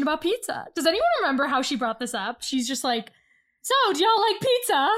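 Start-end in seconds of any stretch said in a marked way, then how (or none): 3.6-4.9 high, chirpy mimicry; pitched-up mock small talk. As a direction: imitate a too-casual conversation opener. Mimics her abrupt pizza question.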